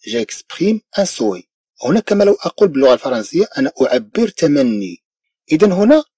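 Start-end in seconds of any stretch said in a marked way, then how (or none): 0.1-1.4 very slow, softer French; slow model reading. Shown as a demonstration. French teacher reading to students, slowly. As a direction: spell it out slowly for learners. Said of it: "أقول مثلًا".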